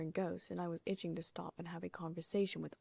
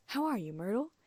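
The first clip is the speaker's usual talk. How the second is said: falsely bright; 4 semitones higher, 5 dB louder than usual. A narrator's reading. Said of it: "in a falsely bright voice".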